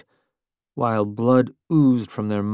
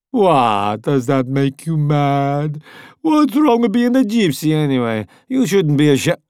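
higher, louder jeering voice; taunting Italian voice; 4 semitones higher, 5 dB louder than usual. A narrator's reading. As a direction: goad him loudly.

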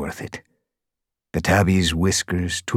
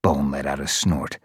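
plain reading voice

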